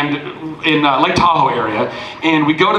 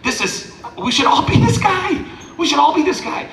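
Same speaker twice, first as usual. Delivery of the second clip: awed realization; 11 semitones higher than usual. Retold or imitated. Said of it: "and I'm like".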